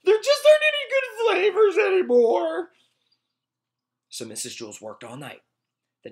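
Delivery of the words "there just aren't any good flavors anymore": much higher, loud sobbing wail; crying out. Acted. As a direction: wail that nothing tastes good. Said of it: "cried Morisa".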